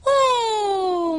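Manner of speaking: high admiring oh; awed oh. A narrator's reading. The friends marvelling at the cloud-curler, awed. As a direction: sigh out in wonder. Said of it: "mondták mindannyian".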